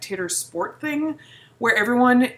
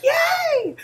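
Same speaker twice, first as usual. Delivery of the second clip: very high mock cheer; exaggerated high-pitched yay; so excited; 4 dB louder than usual. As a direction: squeal it like thrilled relatives. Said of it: "they are so excited".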